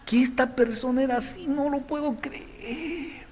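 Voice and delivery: high, hushed, slow gasp of disbelief; shocked disbelief. Retold or imitated. Someone discovering a friend's hidden side, stunned and hushed. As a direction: let the shock sink in slowly, breathless. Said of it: "le vemos el lado del tapete atrás".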